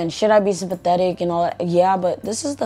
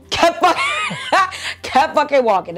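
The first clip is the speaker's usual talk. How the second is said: high, tickled laugh through the words; laughing retelling; 9 semitones higher than usual. Real, not acted. Vlogger telling how mom walked past the crying, laughing. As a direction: break up laughing mid-phrase.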